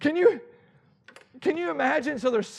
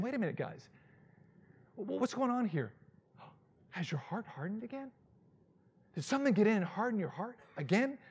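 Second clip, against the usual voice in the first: much softer, tender questioning; gentle concern; 10 dB quieter than usual. Acted. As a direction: ask them gently, almost hushed. Jesus speaks gently, much softer.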